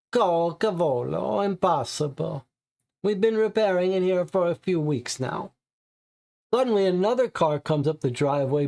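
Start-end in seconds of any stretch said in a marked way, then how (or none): 0.1-5.5 put-on owner brushing it off in Italian accent.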